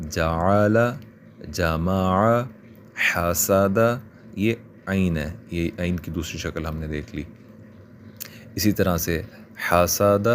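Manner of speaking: each word read out slowly and clearly for learners; word reading drill. Shown as a demonstration. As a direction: read single words like a Quran teacher. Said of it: "اب آگے یوں ہی". (الگ الگ لفظ صاف صاف پڑھنا)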